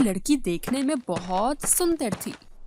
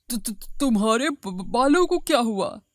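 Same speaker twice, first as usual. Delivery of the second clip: stuttering in disbelief; shocked stammer. Acted. Husband seeing his wife's hair gone, stammering in shock. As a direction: trip over the first sounds, aghast.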